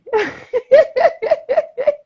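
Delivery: a big squealing burst of laughter; loud high laugh. Real, not acted. Laughing at being told she can't play Eve.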